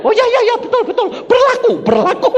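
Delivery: high, loud laughing concession; sheepish laughing yes-yes; laughing agreement. Retold or imitated. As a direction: give in with an embarrassed laugh. Policeman suddenly agrees, laughing.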